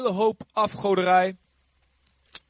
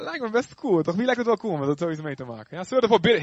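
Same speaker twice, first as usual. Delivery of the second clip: ordinary delivery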